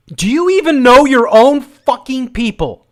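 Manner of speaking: loud, high furious tirade; re-enacting a furious outburst at a woman; angry retold rant. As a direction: yell it at her like you lost it. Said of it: "I'm like".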